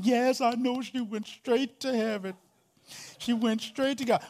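high, sing-song imitation of a pious mourner; lightly mocking a churchgoer who claims she went to heaven.

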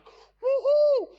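high, loud celebratory woohoo; excited whoop. Acted. Preacher acting out people thrilled by life's wins, excited. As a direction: whoop as if punching the air. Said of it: "things in our life that will get us excited".

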